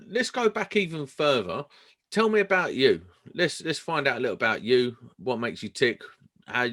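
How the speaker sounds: ordinary talk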